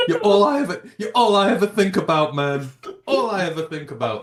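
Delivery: mock devoted coach. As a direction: gush like a coach in love with his team. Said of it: "That's what you should say".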